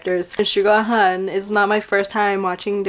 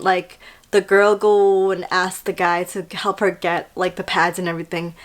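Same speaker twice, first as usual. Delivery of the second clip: ordinary delivery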